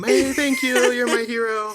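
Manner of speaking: high, loud, over-the-top thanks; hammed-up, desperate gratitude; mock gushing call. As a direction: holler adoringly after a delivery driver. Mock calling to delivery driver.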